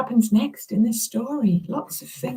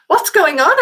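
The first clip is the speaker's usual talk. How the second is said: put-on asking child; 8 semitones higher, 5 dB louder than usual. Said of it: "asked Alfie".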